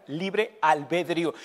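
"libre albedrío" said slowly, word by word; careful pronunciation. Shown as a demonstration. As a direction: sound out "albedrío" slowly for learners. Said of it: "para que lo pronuncien bien".

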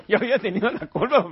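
real amused laugh. Real, not acted. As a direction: laugh through admitting the blame. Laughing at his own fault.

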